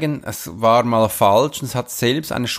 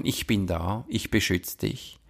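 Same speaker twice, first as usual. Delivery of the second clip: put-on soft, low comfort to a scared child; quiet protective reassurance; reassuring; 6 semitones lower, 9 dB quieter than usual.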